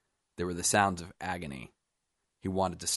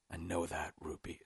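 very soft, weakened voice; faint, weary; 12 dB quieter than usual. A narrator's reading. Dying man answers rambling Roop, faintly. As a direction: answer with little strength left.

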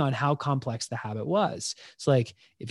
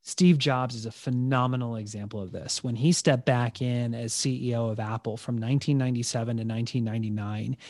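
ordinary talk

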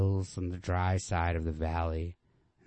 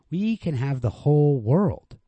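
higher, louder, slow, yearning insistence; high insistent; 9 semitones higher, 10 dB louder than usual. Acted. Girl pushing back against the man, high and insistent. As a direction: reach for the dream out loud.

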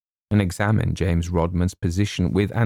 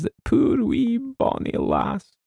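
high, slow, weepy Scots brogue; tearful; slower than usual. Acted. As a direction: choke up over the poor wee lass. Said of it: "he muttered".

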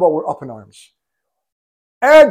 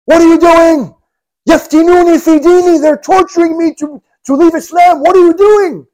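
high, loud desperate scream; 10 semitones higher, 6 dB louder than usual. Retold or imitated. Abu Jandal screaming as they drag him back. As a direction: scream it at the top of your lungs. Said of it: "he's screaming at the top of his lungs".

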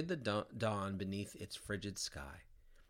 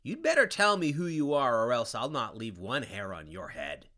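threatening demand; 7 dB louder than usual. A narrator's reading. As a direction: threaten him, full force. Dante grabs his hair.

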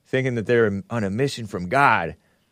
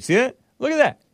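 incredulous; 12 semitones higher than usual. Real, not acted. Pointing out the tweet.

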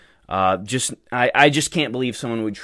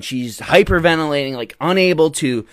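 ordinary talk

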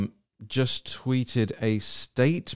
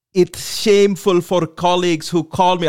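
loud, higher put-on Somali-style accent; Ilhan Omar accent; 6 semitones higher, 5 dB louder than usual. Host reading her tweet in a mock Somali accent. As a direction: do her accent, a bit overdone. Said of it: "I'm gonna do her accent".

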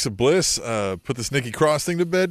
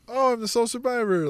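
mock bashful; 8 semitones higher than usual. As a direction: act amazed at your own win. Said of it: "she's just like".

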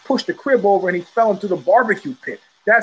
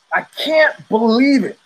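slow, louder, higher incredulous cry; exasperated disbelief; 5 semitones higher, 4 dB louder than usual. Real, not acted. Host cries out in slow, louder disbelief at the fighter. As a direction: drag it out like you can't accept it. Repeats disbelief two ways.

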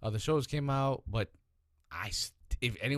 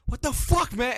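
loud, very high mock outburst; losing it; 12 semitones higher, 8 dB louder than usual. Superman exploding at his lovestruck son, high loud retold outburst. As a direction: imitate a father blowing up in disbelief. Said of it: "he just loses his shit".

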